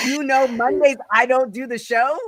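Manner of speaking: high, loud disbelieving echo; mock-outraged high-pitched question; incredulous mocking repeat. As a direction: throw the excuse back in loud disbelief. Incredulously repeats the mayor's retold excuse.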